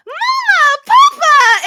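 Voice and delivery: high, loud acted squeal of greeting; delighted cry. Grendel spotting her halfling parents, shouting with joy. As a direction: call out to them in childlike joy. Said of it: "she immediately just goes".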